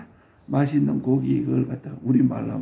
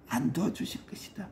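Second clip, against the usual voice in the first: whispered aside; 3 semitones higher, 8 dB quieter than usual. Shown as a demonstration. Preacher repeats line as whisper.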